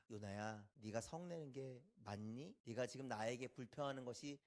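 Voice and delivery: low, soft, gentle reproof; patient questioning. Acted. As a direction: ask quietly as a patient God. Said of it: "요나를 참고 기다리시는 거예요". (낮고 차분하게 타이르는 말투)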